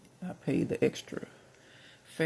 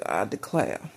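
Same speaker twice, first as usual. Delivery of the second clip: put-on Southern accent; 3 semitones lower, 5 dB louder, slower than usual. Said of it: "this dude on Looney Tunes who talks like that".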